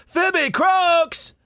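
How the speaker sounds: very high, loud cartoon character yelp; Phoebe Croaks voice. Shown as a demonstration. Voice actor bursting into a character voice, very high and loud. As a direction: announce your own name like a squawking toon. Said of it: "such as Phoebe Croaks".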